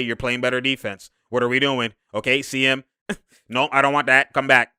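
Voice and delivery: normal talking voice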